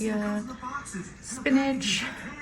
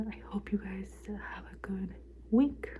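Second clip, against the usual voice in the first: whispering; 10 dB quieter than usual. Real, not acted. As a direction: whisper a gentle goodbye. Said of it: "I'm trying to be quiet".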